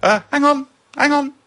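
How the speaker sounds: high, loud call to wait; flustered, urgent calling; calling after them. A narrator's reading. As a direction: call after fleeing visitors. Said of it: "the man replied after them".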